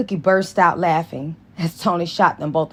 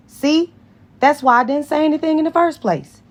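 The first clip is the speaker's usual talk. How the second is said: snippy; 11 semitones higher, 4 dB louder than usual. Acted. Tony snapping back at Carol.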